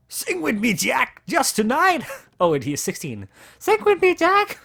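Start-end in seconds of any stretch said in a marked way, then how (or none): 0.1-2.0 pitched up, louder, lilting plea; high boyish Ed voice. Acted. Drunken Ed begs Jack to sing, high boyish plea. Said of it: "Maybe he hasn't hit puberty yet". 3.6-4.6 much higher and louder, squeaky singsong; squeaky boyish voice. Acted. Drunken Ed calls Jack to sing, squeaky high whine. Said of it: "Maybe he hasn't hit puberty yet".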